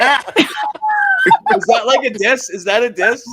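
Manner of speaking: high squeaky disbelief, loud; real startled gasp of a question; shocked squeal. Real, not acted. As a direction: caught off guard and mortified. Shocked at mistaken Samoan remark.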